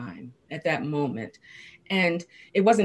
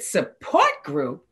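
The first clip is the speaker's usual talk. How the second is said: offended; 4 dB louder, much slower than usual. Retold or imitated. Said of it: "then I got offended".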